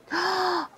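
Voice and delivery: soft, high gasp; long intake of happy surprise. Real, not acted. Reacting to card keyword.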